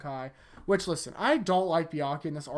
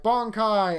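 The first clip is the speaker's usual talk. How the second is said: Bankai called out higher and louder; called-out attack name; 6 semitones higher, 8 dB louder than usual. Retold or imitated. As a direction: shout the release like an anime hero.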